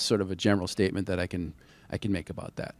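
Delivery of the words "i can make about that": ordinary talk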